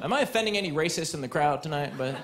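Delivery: ordinary talk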